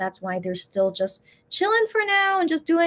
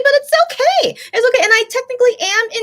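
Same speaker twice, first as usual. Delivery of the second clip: higher and louder, with a giggle; laughing it off; 11 semitones higher, 7 dB louder, faster than usual. Real, not acted. Fan shrugging off missing her idols, laughing and high. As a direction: brush it aside with a laugh.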